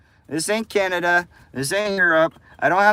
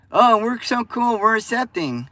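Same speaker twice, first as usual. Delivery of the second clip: mock fake friendliness; 6 semitones higher than usual. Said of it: "They're like".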